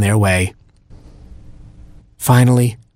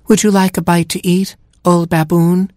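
high, sing-out offer in a put-on giraffe voice; high-pitched polite giraffe question; giraffe chorus; 8 semitones higher than usual. Giraffes offering an old baboon a bite, high put-on voice.

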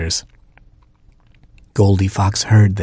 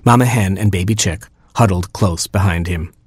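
usual speaking voice

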